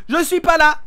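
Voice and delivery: real frantic shouting in game; yelling. Real, not acted. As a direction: shout at teammates in a panic. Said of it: "je gueule".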